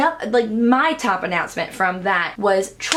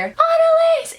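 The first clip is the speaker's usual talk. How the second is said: high, loud call across a hall; 5 dB louder, slower than usual. Retold or imitated. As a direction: shout a name to a friend. Olivia calling her name.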